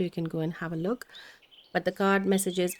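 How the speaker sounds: normal talking voice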